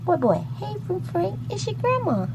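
high coo to the grandson; calling grandson. Real, not acted. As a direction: croon his name up high.

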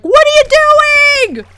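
loud, high, drawn-out mock yell; imitated viewer yelling. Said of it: "you're all yelling at your screen".

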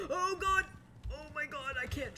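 startled high-pitched yelp; alarmed. Real, not acted. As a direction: gasp and cry out in alarm. Losing control while driving in game.

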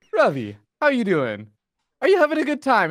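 high, loud cheery check-in; imitating Roddy. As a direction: chirp it like a friendly caster. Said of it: "what Roddy will say is like".

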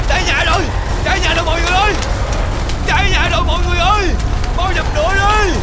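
loud, high panicked shouts for help; yelling fire. Acted. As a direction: scream to the whole village that the house is burning. Gọi mọi người khi nhà cháy. (hốt hoảng la lớn)

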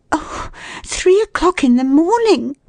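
very high, wailing dismay; dismayed lady. A narrator's reading. Stranded woman hears the hour, dismayed. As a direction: exclaim in high-pitched alarm.

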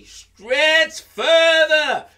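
high, loud, slow drawn call; coaxing call. Acted. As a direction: urge him on, stretched out. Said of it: "Anansi say".

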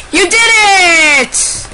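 very high, loud cheer; elated, high-pitched celebration; victory shout. Real, not acted. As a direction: yell it in triumph. Celebrates the dog's win.

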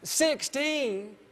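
put-on high, incredulous drunk wisecrack; tipsy friend mocking the count; drunk buddy's quip. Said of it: "he goes".

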